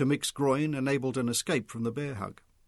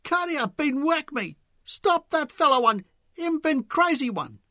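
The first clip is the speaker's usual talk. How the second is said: very high, slow squeal; 7 dB louder than usual. A narrator's reading. Arnold squealing as he crumples to the ground, very high. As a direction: squeal dramatically.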